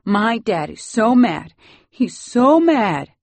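high, loud, drawn-out crying voice; tearful child's wail. A narrator's reading. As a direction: wail slowly like a boy collapsing in tears. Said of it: "shaking with the force of his tears".

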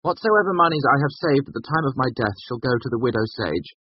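high, formal proclamation of a will; loud dictation. A narrator's reading. Bast dictating his will across the room, loudly. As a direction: pronounce it for the whole room to hear. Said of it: "Bast said loudly across the room".

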